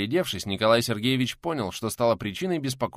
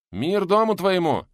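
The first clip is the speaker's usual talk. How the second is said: loud, high call at a doorway; 10 semitones higher, 7 dB louder, slower than usual. Acted. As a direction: hail the house from outside. Calling out at the door.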